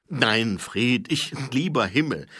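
loud, stammering protest; Nobby caught out by Fred; flustered. Acted. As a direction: scramble for words.

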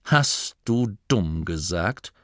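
a duke's icy warning; cold menace. Acted. Duke offended, face reddens.

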